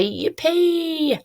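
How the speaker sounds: high, drawn-out cheer; excited squeal up high; exclaimed yippee. Shown as a demonstration. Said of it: "now I really say that".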